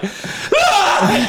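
very high laughing yelp; startled scream. Retold or imitated. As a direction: squeal like you're startled. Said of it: "And she was like".

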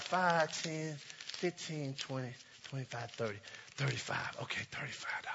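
low, soft near-whisper counting; counting under breath. Real, not acted. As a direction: count the bills to yourself.

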